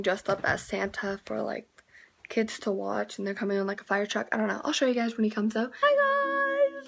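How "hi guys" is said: high, loud excited squeal. Real, not acted. Bright greeting to camera.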